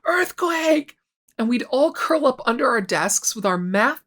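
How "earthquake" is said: high, drawn-out mock alarm call; retold shout, pitched well up; teacher's sudden shout. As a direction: imitate a teacher yelling out a drill warning. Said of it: "The teacher would randomly shout".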